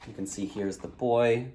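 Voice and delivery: plain reading voice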